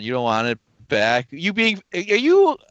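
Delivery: ordinary talk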